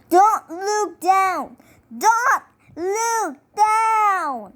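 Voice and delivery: high, slow calling out; shouting encouragement. A narrator's reading. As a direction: call it out from down the street. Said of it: "Robbie screaming".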